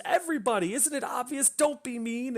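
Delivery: high, put-on wounded retort; upset young woman. Retold upset woman argues back.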